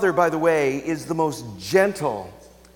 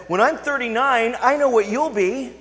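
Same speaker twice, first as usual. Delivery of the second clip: put-on child's voice; 7 semitones higher than usual. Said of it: "came up ... and said".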